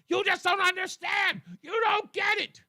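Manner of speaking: high, loud mocking shriek; mock screaming. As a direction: shriek it like a hysterical caller. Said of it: "Screaming and yelling at me".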